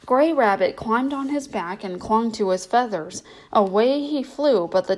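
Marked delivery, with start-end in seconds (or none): none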